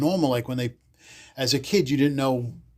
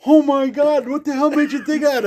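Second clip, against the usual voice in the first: much higher, louder astonished question; astonished principal; 12 semitones higher, 7 dB louder than usual. Retold or imitated. Principal asking pupil about the prank, astonished. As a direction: gasp it out in amazed disbelief. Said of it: "he goes".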